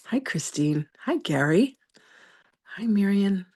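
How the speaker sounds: normal talking voice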